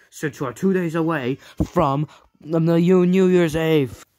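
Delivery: loud exasperated shout. Acted. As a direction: blurt it out, loud. Exasperated outburst.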